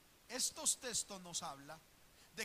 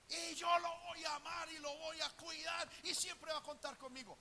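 loud, higher, slow proud declaration; defiant parent; 9 semitones higher, 8 dB louder than usual. Retold or imitated. Parent vowing loyalty to wayward son, loud and proud. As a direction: shout it like a defiant loving father. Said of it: "varias personas que han dicho".

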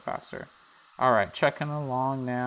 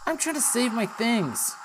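annoyed villager; 13 semitones higher, 5 dB louder than usual. Acted. Garrett grumbling at Isaac, loud and high. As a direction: brush the kid off, put upon. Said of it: "He's like".

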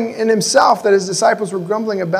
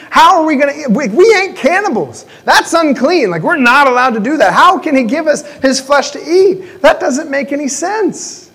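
higher, incredulous voice of the doubting crowd; mock outraged crowd; 6 semitones higher than usual. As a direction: play the baffled listeners, pitched up.

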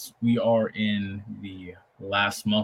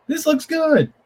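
much higher, louder excited squeak; high squeal; 7 dB louder, slower than usual. Acted. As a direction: squeal it out with delight. Squeals at new background art.

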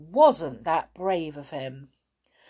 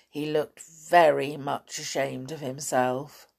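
usual speaking voice

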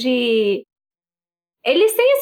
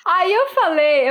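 ordinary delivery

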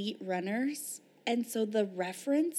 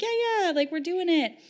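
mock breezy bragging; 9 semitones higher, 4 dB louder than usual. Mocking breezy social media posts.

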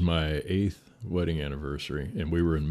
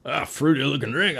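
raised, louder New York voice; mock New York brogue, pitched up; 10 semitones higher, 7 dB louder than usual. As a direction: imitate a scoffing New Yorker. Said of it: "great New York brogue".

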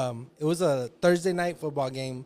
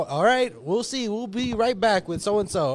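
a bright broadcast sign-off, higher and louder; upbeat announcer wrap; on-air host voice; 5 semitones higher, 3 dB louder, slower than usual. Retold or imitated. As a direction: chipper TV host going to break. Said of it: "Michael Irvin goes from this".

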